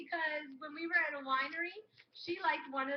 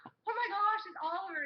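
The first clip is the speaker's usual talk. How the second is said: high-pitched, louder gasp of alarm; retold panic; 6 semitones higher, 3 dB louder than usual. Telling roommate who is calling, high retold alarm. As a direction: exclaim in mock panic, starting high. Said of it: "I'm panicking".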